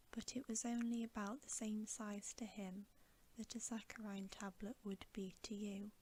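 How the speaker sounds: plain reading voice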